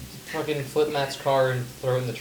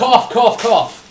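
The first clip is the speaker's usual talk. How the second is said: fake cough; 8 semitones higher, 12 dB louder, much slower than usual. Fake coughing words at friend.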